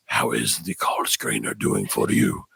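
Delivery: mock sinister boss asking; deep slow menacing voice. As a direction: growl it like a villain. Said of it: "he's like".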